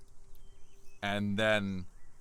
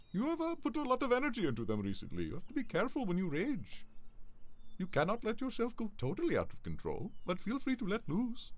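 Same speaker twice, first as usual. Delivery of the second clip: high, fast put-on mentor voice; 11 semitones higher, faster than usual. Klaus advising Lucian after his rage, high and fast. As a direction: lecture him kindly like an elder.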